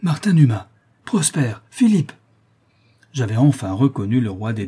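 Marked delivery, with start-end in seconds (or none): 0.0-2.1 loud, high exclamation of recognition; crying out names. Acted. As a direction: call out the names in relief. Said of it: "Et je m'écriai".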